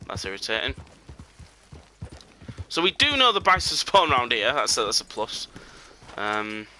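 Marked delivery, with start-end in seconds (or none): none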